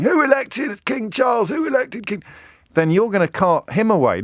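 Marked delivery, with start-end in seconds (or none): 0.0-2.2 very high, shouted heckle; mock protester shout. Protester heckling at the proclamation, very high shouted call. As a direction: bellow it across a crowd like a protester. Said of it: "this bloke who's shouting".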